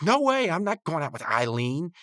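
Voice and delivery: high-pitched mock protest; indignant refusal. Rigby refusing a date with Eileen, high mock indignation. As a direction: imitate a cartoon character digging in.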